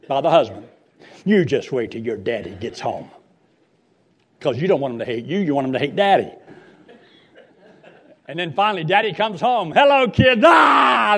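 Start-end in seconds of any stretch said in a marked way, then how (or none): 1.3-3.1 low mock mother's threat. 9.7-10.4 loud, high dad greeting; booming daddy greeting. Retold or imitated. Daddy arrives home. 10.5-11.2 high loud fleeing scream; kids' scream. Retold or imitated. Kids scream and flee.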